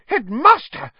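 high, loud, outraged splutter. A narrator's reading. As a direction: splutter in protest, shrieking high. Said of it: "spluttered Madam Pumphrey".

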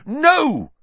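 high, loud cry of protest; sudden high shout; yelling. A narrator's reading. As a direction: yell it out urgently. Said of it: "Harry yelled".

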